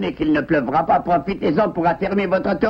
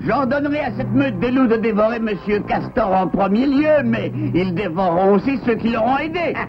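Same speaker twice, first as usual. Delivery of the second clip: ordinary talk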